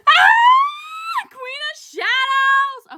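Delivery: a high squeal, then the title called out slowly; excited scream. Real, not acted. Vlogger shrieking her top book's title at viewers, overjoyed. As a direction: shriek the title like a fan who can't hold it in. Announcing her number two book.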